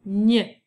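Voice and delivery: "ñ" as the French gn sound; isolated gn sound. Shown as a demonstration. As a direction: sound out "ñ" like the end of montagne. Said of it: "qui fait le son". (« ñ », le son gn de montagne)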